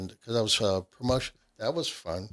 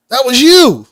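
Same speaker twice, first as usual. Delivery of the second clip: surprised exclamation; 11 semitones higher, 16 dB louder than usual. Retold or imitated. Manager recognizing the radio voice.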